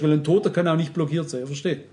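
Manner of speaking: usual speaking voice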